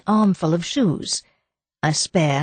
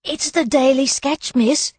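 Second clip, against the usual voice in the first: higher-pitched excited maid; excited announcement; 8 semitones higher than usual. A narrator's reading. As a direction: announce it with raised, eager pitch. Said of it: "she announced excitedly".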